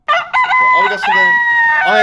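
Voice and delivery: very high squeak of joy; squeaky voice. Pretend. Streamer squealing in a cartoon-high voice over finishing.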